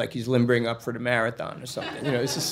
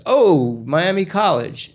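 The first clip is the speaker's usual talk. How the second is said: loud, high, drawn-out delight; high-pitched burst of recognition; excited recognition; 6 semitones higher, 7 dB louder, slower than usual. Retold or imitated. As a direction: exclaim it with big, stretched enthusiasm. Cop's delighted recognition, acted out.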